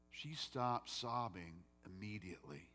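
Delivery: ordinary delivery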